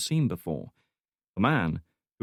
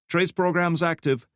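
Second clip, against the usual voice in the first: lightly voiced young analyst, higher; young analyst Santiago; 9 semitones higher, 4 dB louder than usual. A narrator's reading. Young analyst reporting to his boss, lightly higher voice. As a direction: report it briskly, eyes on screen.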